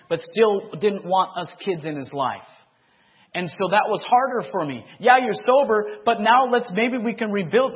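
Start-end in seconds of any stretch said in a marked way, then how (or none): 5.0-7.7 high, loud pleading; father begging his son on the phone, loud and high. Retold or imitated. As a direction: plead to rebuild the bond.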